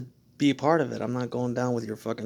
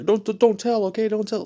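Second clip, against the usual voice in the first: mock begging; 9 semitones higher, 6 dB louder than usual. Mimics a nervous person begging.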